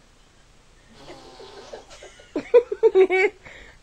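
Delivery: long high-pitched laugh; laughing fit. Real, not acted. Laughing at the girl's antics, high laughing fit. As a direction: laugh helplessly, high and loud.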